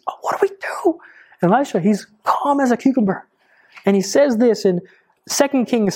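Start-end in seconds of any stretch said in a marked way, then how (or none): 0.1-1.0 high, breathless whispered panic; a terrified servant gasping for help; panicked servant. Retold or imitated. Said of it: "He is so afraid".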